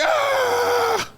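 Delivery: long high-pitched mock wail; hammed-up drawn-out kid's "aah"; frustrated scream. Acts out kid's frustrated cry.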